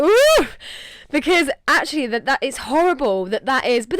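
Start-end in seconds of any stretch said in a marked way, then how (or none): 0.0-0.5 emotional yelp. Real, not acted. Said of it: "I was like".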